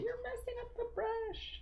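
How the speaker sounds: high mock voice of a picky viewer; imagined critic. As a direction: squeak it out like a fussy know-it-all viewer. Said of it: "somebody out there going".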